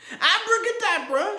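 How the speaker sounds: yelling beside himself with rage; furious shout. A narrator's reading. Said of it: "shouted Mister Curry beside himself".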